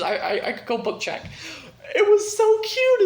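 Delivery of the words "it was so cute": high, loud cooing delight; gushing squeal. Real, not acted. Reader gushing about a favourite book chapter, squealing. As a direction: squeal with real adoration.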